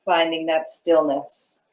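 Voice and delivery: unhurried meditation guide with long pauses; guided meditation. Acted. Meditation leader settling students into their breath, calm guiding voice. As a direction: ease them into stillness, phrase by phrase.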